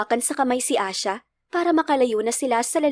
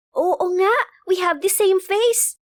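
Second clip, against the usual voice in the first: put-on high, slow little-girl voice; Asha; 7 semitones higher, slower than usual. A narrator's reading. Girl seeing her lookalike, high childlike voice. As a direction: agree as an amazed small child. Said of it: "ani Asha".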